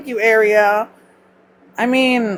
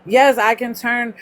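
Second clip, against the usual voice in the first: ordinary delivery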